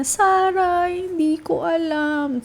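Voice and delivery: high, drawn-out mock lament; slow, high-pitched plaintive imitation; upset mom. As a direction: imitate a mom wailing lightly to her daughter. Imitating mom's upset reaction.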